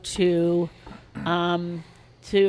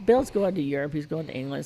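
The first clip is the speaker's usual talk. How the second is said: ordinary talk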